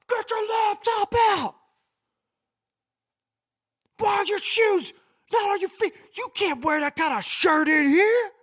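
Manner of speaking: shrill shouting airport agent; bossy screener. Retold or imitated. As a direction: yell orders like a power-tripping screener. Strict security screener barking.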